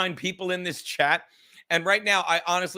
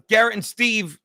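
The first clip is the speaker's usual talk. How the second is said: ordinary talk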